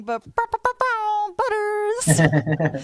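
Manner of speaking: cat soundboard noise. Pretend.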